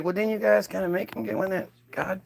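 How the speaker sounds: muttered thought. Retold or imitated. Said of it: "he was like".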